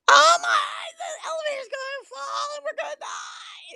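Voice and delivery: very high mock-terrified squeal; loud, over-the-top falsetto shriek; panicked ride passenger. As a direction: wail it out in hammed-up panic. Same panicking passenger, in stretcher room.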